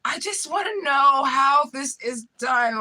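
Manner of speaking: high, very drawn-out mock swooning; over-the-top gushing. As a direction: stretch every word high like a smitten admirer. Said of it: "So he did violate".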